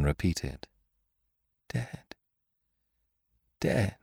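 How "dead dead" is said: soft, stunned repetition, higher pitched; reflective. A narrator's reading. Lupin over Raymond's body, quietly reflective. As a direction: say it softly, not yet understanding. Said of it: "He said it in a reflective tone".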